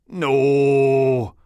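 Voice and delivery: high, loud wail of No; anguished no. Acted. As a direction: cry out as if struck. Biohazard's anguished refusal.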